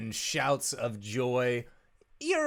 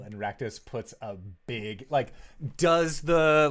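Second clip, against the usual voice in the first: ordinary talk, nothing marked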